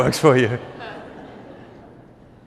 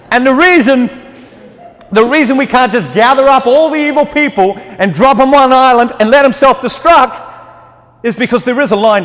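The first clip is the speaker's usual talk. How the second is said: higher, louder pulpit preaching, pressing the point; fervent preaching; 8 semitones higher, 5 dB louder than usual. Acted. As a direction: preach it out to the whole church. Raised preaching passage.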